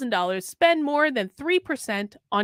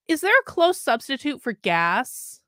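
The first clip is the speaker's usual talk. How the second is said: ordinary talk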